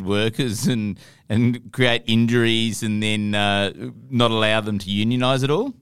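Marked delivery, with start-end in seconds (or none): none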